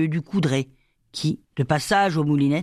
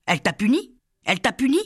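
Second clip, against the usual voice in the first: high, insistent repeated question; anxious, sharply rising questions; urgent questioning; 6 semitones higher, faster than usual. A narrator's reading. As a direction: press harder each time, pitch climbing. Repeats question to sobbing child.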